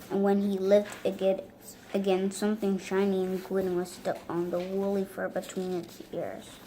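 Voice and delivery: ordinary delivery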